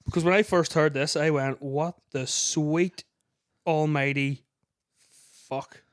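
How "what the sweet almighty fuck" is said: very slow, word by word; retold astonishment, spaced out for effect; stunned disbelief. Quoting own stunned reaction.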